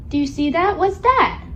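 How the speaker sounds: ordinary delivery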